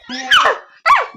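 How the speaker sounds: high-pitched yelp; two quick high cries; short scream. Real, not acted. Startled cries during game. (matinis na tili)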